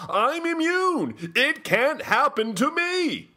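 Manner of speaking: mock smug boast. Host mocks complacent leaders, high, slow smug boast. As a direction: squeak it out like a smug fool. Mocking complacent people's thinking.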